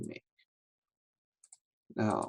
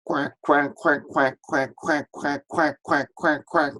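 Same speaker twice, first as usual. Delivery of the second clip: duck quack; 4 semitones higher than usual. Shown as a demonstration. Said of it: "the sounds duck makes".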